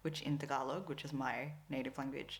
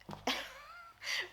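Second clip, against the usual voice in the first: high squeaky laugh. Real, not acted. Laughing at the pattern.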